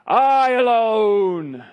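slow, drawn-out loud wail; I alone dragged out high and loud; mock self-pity. Mocking Elijah's self-pity.

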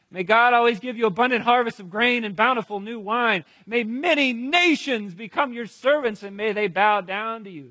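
high, forceful patriarchal blessing; proclaimed blessing. Acted. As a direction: pronounce it boldly over your son. Isaac's blessing proclaimed aloud.

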